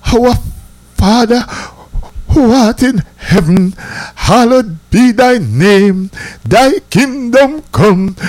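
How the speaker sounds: slow, sing-song group recitation; a light mock of kids reciting the Lord's Prayer; recited prayer. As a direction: chant it slowly like kids in a youth club. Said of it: "make we repeat the Our Father prayer".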